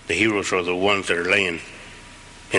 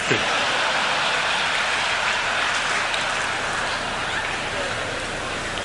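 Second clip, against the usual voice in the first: a soft, high laugh with almost no voice; breathy laugh; 12 semitones higher, 3 dB quieter than usual. Real, not acted. Laughing about the nurse who kept pinching him. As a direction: let out a quiet airy laugh at the memory.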